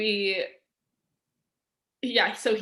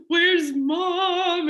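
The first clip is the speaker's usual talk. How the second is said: high and loud, a tearful call for mom; crying out for mom in distress; 9 semitones higher, 6 dB louder than usual. Retold or imitated. To her stepdad downstairs, high, loud and tearful.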